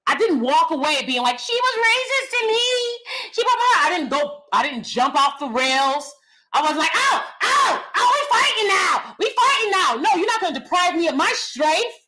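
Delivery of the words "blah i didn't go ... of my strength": normal talking voice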